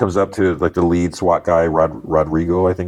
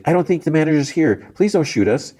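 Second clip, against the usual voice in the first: frightened lady; 10 semitones higher than usual. Retold or imitated. Frightened lady hostage pleads.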